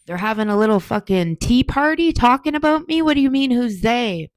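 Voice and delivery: high and loud, fed up; outraged rant. Real, not acted. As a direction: blow up about the family talking behind her back. Outraged at family gossip.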